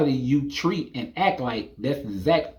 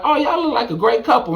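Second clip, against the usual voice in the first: admiring stranger; 8 semitones higher, faster than usual. Retold or imitated. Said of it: "somebody like".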